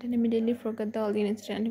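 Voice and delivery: ordinary delivery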